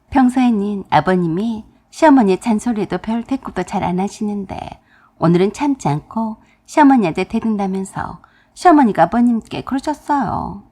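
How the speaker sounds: ordinary delivery, nothing marked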